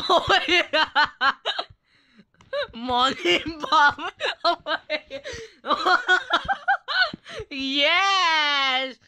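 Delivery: slow, drawn out through laughter; laughing. Real, not acted. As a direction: say it while cracking up. Laughing at the name.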